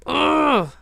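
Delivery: loud, high "ugh" of frustration; frustrated groan. Acted. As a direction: groan it out, acting impatient. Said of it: "That frustration that you get".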